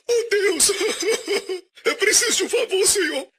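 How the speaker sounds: high, laughing mock beggar praying; a hammed-up whiny plea to God; mock pleading prayer. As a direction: parody a desperate prayer while laughing. Mocking a begging prayer.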